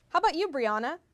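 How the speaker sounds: ordinary talk, nothing marked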